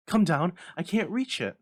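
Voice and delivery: loud, high calls for help; strained panting cry. Acted. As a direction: shout up at her husband, straining. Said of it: "strained and panting".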